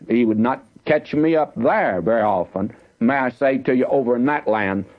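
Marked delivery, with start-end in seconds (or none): none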